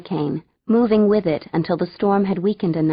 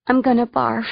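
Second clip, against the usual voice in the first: plain reading voice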